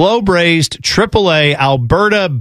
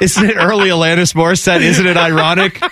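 laughing; 3 semitones higher than usual. Real, not acted.

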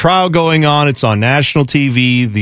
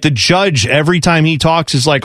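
usual speaking voice

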